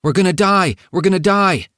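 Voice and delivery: high, loud cry of panic, voiced by the narrator; panicked scream. A narrator's reading. Physician's assistant on a plane in a thunderstorm, screaming in panic. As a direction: read it as a frantic, high-pitched outcry. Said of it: "was screaming uncontrollably".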